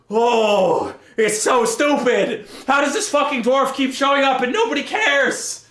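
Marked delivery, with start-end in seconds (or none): none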